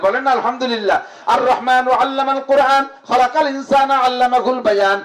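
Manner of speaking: rousing high preacher voice; fiery preaching. Acted. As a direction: preach it out to the crowd. Preaching at full force.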